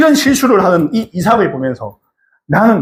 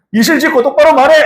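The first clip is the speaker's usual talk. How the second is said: high, loud commanding yell; angry shout; 8 semitones higher, 3 dB louder than usual. Acted. King demands Isaac tell the truth, shouted. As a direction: bark the order to tell the truth. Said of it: "이렇게 얘기한 거죠".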